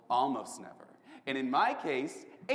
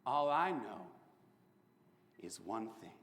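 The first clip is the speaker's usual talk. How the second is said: ordinary talk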